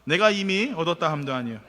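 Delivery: ordinary delivery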